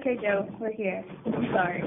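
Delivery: quiet. Real, not acted. Recording apology for Joe, hushed.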